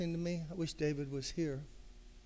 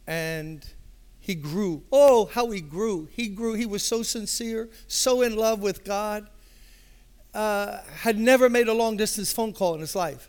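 rising preacher fervor; 6 semitones higher, 9 dB louder than usual. Real, not acted. Preacher marveling to the congregation at David's growth, rising fervor. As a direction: swell into it, marveling.

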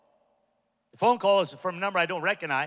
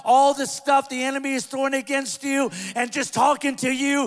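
loud, high, driving sermon cadence; full-force preaching; 10 semitones higher, 9 dB louder than usual. Acted. Pastor to the congregation, loud preaching. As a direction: preach it out to the whole room.

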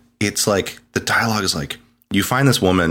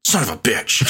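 a put-on drawled curse; mock biker accent; 5 semitones higher than usual. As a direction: land it like a cheesy punchline. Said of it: "Deacon goes".

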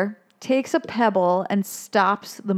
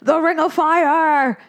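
fish gang voice; 5 semitones higher, 3 dB louder than usual. Retold or imitated. Fish gang initiating newcomer.

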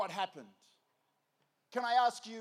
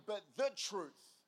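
slow, weighted "the" before "truth"; stress on THE; slower than usual. Shown as a demonstration. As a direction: lean hard on "the", drawing it out. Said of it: "not a truth".

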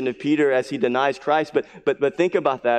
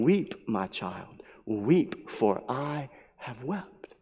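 low, slow and much softer, a gentle comforting voice; Christ's tender voice; 5 semitones lower, 8 dB quieter, much slower than usual. Pretend. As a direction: speak as Christ soothing a weeping child. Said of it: "Christ stands by each of us saying".

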